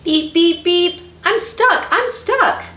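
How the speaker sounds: high, loud, urgent beeping voice; little truck crying out. A narrator's reading. Blue calls the animals to help, high loud cry. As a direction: call for rescue in a high voice. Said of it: "cried the Little Blue Truck".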